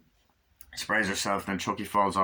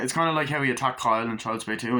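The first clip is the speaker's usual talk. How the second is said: ordinary delivery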